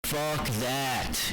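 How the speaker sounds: loud, high mock outrage; defiant catchphrase. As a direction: belt it out as a rant slogan. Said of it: "You know what I say?".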